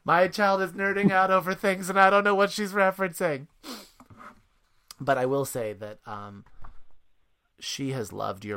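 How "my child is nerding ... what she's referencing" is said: high, held-up voice of an overwhelmed parent; mock parental bewilderment. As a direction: exclaim in comic dismay, pitch staying up. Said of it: "It was like".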